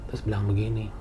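usual speaking voice

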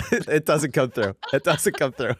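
high, loud words through laughter; laughing. Real, not acted. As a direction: crack up while saying it. Laughing at failed harmonizing attempt.

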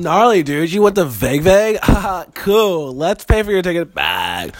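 loud, high hammed-up Cali surfer-talk mom; Californian mom. Co-host imagines friend's California mom hearing about Vegas, hammed-up surfer talk. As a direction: play a laid-back California mom, big and high. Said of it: "she grew up in California".

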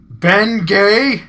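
put-on high, loud, abrupt old man's query; loud blurted question. Old customer asks the clerk, loud and abrupt. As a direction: bark the product name like a puzzled old man.

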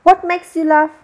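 high, loud irritable demand; sharp angry shout; snapped angrily. A narrator's reading. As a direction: snap at someone for laughing. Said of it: "तो मध्येच खेकसला".

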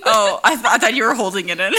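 giggling through the words, quick; laughing. Real, not acted. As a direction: laugh while you tease. Teasing friend about a yawn.

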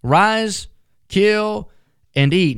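booming voice of God, measured words; voice from heaven. Acted. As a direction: call down from heaven, slow and grand. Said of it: "a voice from the other world says".

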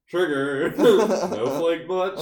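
loud, high, drawn-out parody jeer; mock right-wing guy. Joker heckling snowflakes as a parody right-wing guy. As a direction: stretch the taunt out, hamming it up. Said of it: "twenty sixteen right-wing guy".

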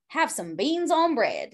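put-on slow, lightly drawled Southern accent. Mocking a beans-on-bread server to cohost, slow Southern accent. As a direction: drawl it out Southern, half-joking. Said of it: "or Southern accent".

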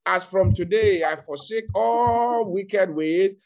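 loud, high fervent preaching; full-force preaching. Acted. Pastor urging congregation to pray aloud, fervent shouting. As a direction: preach it out with all your strength.

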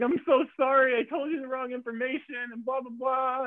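high, mock-tearful apology; imitated crying. Crew member apologising for wrong information, mock crying.

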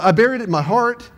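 put-on high-pitched Southern accent. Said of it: "I said that so Southern".

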